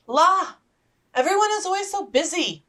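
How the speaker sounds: put-on flighty young sister; giddy Lydia. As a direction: toss it off like a flighty girl.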